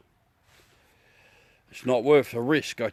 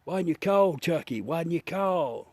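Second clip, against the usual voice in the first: put-on quoting an old ad; 6 semitones higher than usual. Streamer repeating the ad catchphrase for laughs, higher mock voice. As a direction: overplay the ad's pleading line. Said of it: "Remember that ad".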